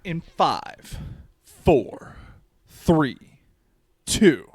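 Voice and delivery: countdown. Acted. Countdown to show start.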